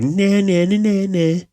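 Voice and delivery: put-on sing-song a cheeky kid saying nah; mocking kid. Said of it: "He's like".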